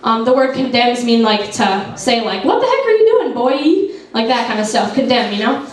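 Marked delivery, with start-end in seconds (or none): 2.4-3.9 high, sharp mock scolding of a kid; a hammed-up stern scolding, much higher. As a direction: scold him like a stern adult catching a boy. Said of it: "Scolding you".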